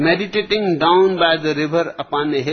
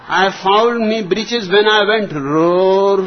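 higher and louder punchline; exasperated shout; 4 semitones higher, 6 dB louder than usual. Acted. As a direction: shout it out, fed up. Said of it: "stuttered the old colonel".

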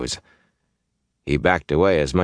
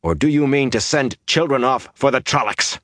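raised, tense challenge; indignant, higher-pitched and tight; 9 semitones higher than usual. A narrator's reading. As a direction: challenge them with tightly held anger. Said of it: "he said in a tight voice".

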